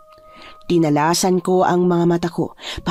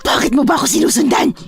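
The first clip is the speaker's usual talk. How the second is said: higher, put-on creature voice; taunting monster; 5 semitones higher than usual. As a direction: taunt your pursuer as the monster. (nanunuyang boses ng halimaw)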